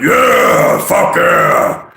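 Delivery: loud, high-pitched metal growl; Cookie Monster metal vocals. Shown as a demonstration. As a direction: belt out a growled thrash-metal yell. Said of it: "like, Cookie Monster heavy metal vocals".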